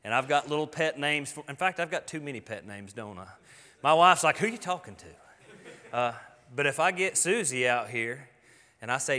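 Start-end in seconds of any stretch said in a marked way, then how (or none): 4.4-6.0 slow, drawn-out mock question; lightly voiced wife, teasing; wife overhearing baby talk, mildly mock-puzzled; a hint of a puzzled wife.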